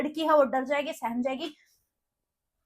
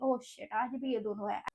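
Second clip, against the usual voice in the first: lower, softer, slower annoyed aside; muttered reaction; 7 semitones lower, 10 dB quieter, slower than usual. Retold or imitated. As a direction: mutter it under her breath. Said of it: "वो भी ऐसे react कर रही थी". (धीमे से झुंझलाकर बुदबुदाना)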